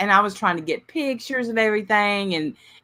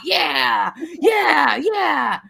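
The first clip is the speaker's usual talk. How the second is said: high, loud rallying cries; psyched-up cheering; 7 semitones higher, 3 dB louder, much slower than usual. Retold or imitated. Coach answering the woman in labor, re-enacted pumped-up yell. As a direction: chant it back, pumped up.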